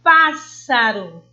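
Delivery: slow pássaro with a loud, long PÁS; PÁÁS-saro, strong opening syllable; stress on the first syllable. Shown as a demonstration. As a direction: punch the PÁS in pássaro, let the rest drop. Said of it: "a sílaba tônica é a antepenúltima".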